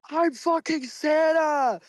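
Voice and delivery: usual speaking voice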